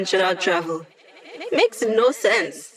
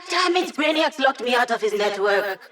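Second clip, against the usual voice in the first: ordinary talk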